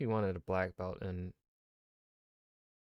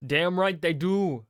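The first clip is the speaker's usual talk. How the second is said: excited; 9 semitones higher, 9 dB louder, slower than usual. Real, not acted. Enthusiastic agreement about Morocco.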